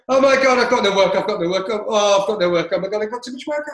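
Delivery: mock panic. Imitating himself panicking over no work, high mock fret. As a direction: wail about having no work. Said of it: "it'd go like this".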